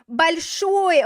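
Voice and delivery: большой with the last syllable loud and long; большой, landing hard and high on the ending; stress on final syllable. Shown as a demonstration. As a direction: punch the second syllable of большой. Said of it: "the stress is on the, the final syllable".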